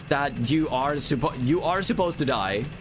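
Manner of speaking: ordinary delivery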